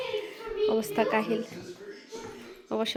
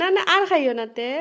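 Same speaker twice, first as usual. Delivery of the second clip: firm no; 6 semitones higher, 5 dB louder than usual. Real, not acted. Mother stops child eating cake.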